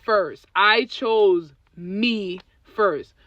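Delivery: very slow, weighty words; slow translation. Shown as a demonstration. Host translating her friend's point very slowly. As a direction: spell it out slowly so it sinks in. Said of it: "let me translate".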